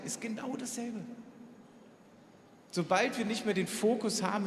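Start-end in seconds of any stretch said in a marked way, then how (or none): 0.1-1.0 repeated much softer, dropping away; soft echo. Shown as a demonstration. Preacher echoing his point to the congregation, softly. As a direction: let the repeat fall quiet. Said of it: "Das ist genau dasselbe".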